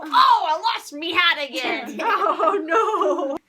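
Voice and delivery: loud laughing mock dismay. Pirate host playfully wails about her hat. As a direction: ham up losing the hat.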